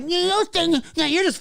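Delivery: high-pitched mock complainer; mocking the critics. Streamer imitating the band's detractors, mocking high voice. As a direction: squeal it like a petty naysayer. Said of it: "So you people out there like".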